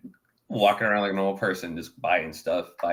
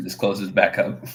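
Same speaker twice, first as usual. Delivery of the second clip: normal talking voice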